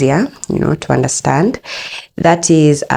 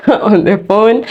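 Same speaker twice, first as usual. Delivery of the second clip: usual speaking voice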